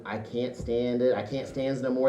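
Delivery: lightly voiced fed-up Popeye. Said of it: "that Popeye moment".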